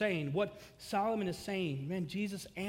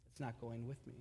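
softer, lower resignation; sudden hush; 8 semitones lower, 13 dB quieter than usual. Acted. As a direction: drop to a near-silent, low murmur. Solomon's realisation, dropped low.

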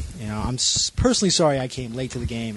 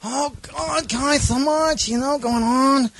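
character voice; 10 semitones higher than usual. Acted.

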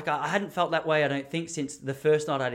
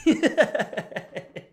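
loud, high burst of laughter; real laugh; 8 semitones higher, 4 dB louder than usual. Real, not acted. Comedian recalls a bloke's blunt post-gig remark, laughing. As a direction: crack up at the remembered put-down.

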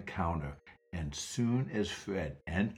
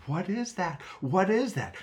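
higher, anxious; frightened boy; 10 semitones higher than usual. Acted. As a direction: ask nervously, clinging close. Scared boy asks.

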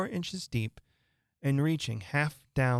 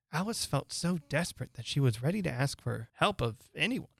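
plain reading voice

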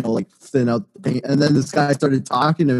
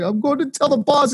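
tattletale whine; 10 semitones higher than usual. Retold or imitated. Childish threat to tattle.

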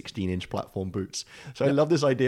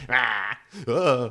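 mock frightened yelps; 4 dB louder than usual. Fleeing fan imitated.

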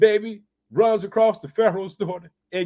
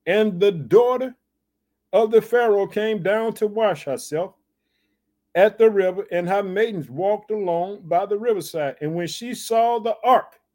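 raised sermon reading; scripture reading; 4 semitones higher, 3 dB louder than usual. Acted. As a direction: proclaim the verse. Preacher rereads the scripture.

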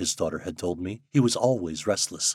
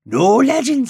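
insistent cry; 11 dB louder, slower than usual. Acted. Old man insists, repeats.

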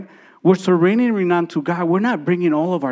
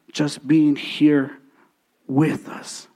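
tender altar call; 3 semitones lower than usual. Acted. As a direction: draw them in gently, almost whispering. Quiet altar call, softening to a whisper.

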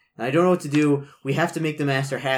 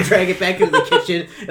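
ordinary talk

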